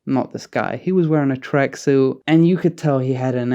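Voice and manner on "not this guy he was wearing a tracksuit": ordinary talk, nothing marked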